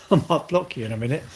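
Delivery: ordinary talk, nothing marked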